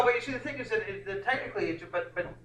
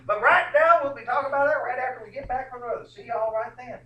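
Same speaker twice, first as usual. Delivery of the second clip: higher, louder hammed-up anchor voice; mock newscaster signoff; 9 semitones higher, 9 dB louder than usual. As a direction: sign off like a parody news anchor. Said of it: "do you ever hear any of the newscasters saying".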